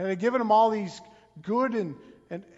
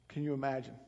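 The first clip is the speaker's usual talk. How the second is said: hushed question; 7 semitones lower, 10 dB quieter, much faster than usual. Acted. Same question, hushed and falling.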